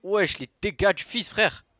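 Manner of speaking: shrieked high and very loud; raging gamer. Real, not acted. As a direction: scream it at full rage. Repeat, even higher scream.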